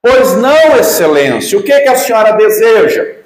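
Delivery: shouted high and loud, mimicking the judge; imitating a judge screaming the required answer; mock shout. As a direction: yell it like a furious judge demanding a reply. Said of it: "mas gritando".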